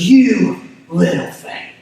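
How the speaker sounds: slow, drawn-out "You little faith", a touch accusatory; pointed rebuke. Retold or imitated. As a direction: lightly play it as a stern jab. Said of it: "kind of harsh or, or pointed, uh, or accusatory".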